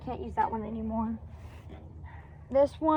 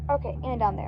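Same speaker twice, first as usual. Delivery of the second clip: normal talking voice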